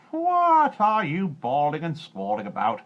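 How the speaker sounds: put-on a bizarre little man roaring at the girl. A narrator's reading. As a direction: bellow it as a cranky imp. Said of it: "he roared".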